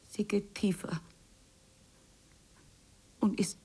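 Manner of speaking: hesitant whisper; whispered, afraid of the question. Acted. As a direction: barely dare to say it. Hesitating before third question.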